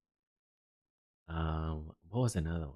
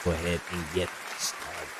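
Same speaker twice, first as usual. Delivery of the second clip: announcer-style welcome; 8 semitones higher than usual. Acted. Host welcoming viewers back in studio, announcer style.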